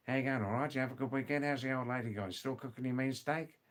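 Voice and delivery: thick Aussie, words run together; extreme Australian accent. Shown as a demonstration. Teacher repeats the Australian greeting to be translated, broad Aussie accent. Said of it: "not extreme Australian English, like".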